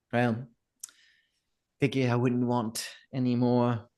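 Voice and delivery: lightly voiced innkeeper, holding back annoyance; a hint of dry restraint through gritted teeth; annoyed innkeeper. Innkeeper to the man who gave a speech, restrained annoyance. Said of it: "actively annoyed, like, like, gritting her teeth".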